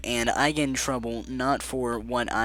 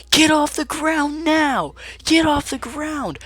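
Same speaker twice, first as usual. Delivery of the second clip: much higher, louder, slow mock yell; imitating a yelling coach; 6 dB louder, slower than usual. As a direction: bellow it like a furious gym coach. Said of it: "she's yelling".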